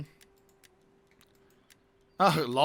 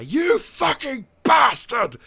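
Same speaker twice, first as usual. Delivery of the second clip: much higher, much louder, slow raging yell; furious shout; 13 semitones higher, 11 dB louder, much slower than usual. Acted. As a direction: roar it at the enemy, stretched out. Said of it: "no, I will say".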